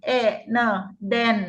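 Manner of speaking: slow, segmented "เอะ เนอะ den"; sounding out den slowly for a child. Shown as a demonstration. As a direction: draw out each sound for the child to copy.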